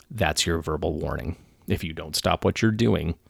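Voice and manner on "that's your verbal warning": ordinary talk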